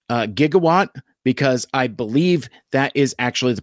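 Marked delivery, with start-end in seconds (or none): 0.3-0.9 "Gigawatt" with a hard g, as in go; proper hard-g pronunciation. Retold or imitated. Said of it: "proper pronunciation".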